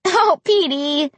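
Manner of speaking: high, drawn-out affectionate exclamation; happy cry. A narrator's reading. Girl hugging her sweet dog, crying out happily. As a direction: cry out the dog's name with delight. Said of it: "I cried".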